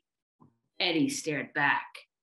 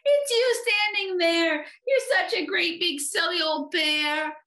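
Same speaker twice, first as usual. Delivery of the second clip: put-on high, gleeful little-boy squeal; giggly teasing; 12 semitones higher than usual. A narrator's reading. Eddie teases the silly bear, gleefully giggly. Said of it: "giggled Eddie".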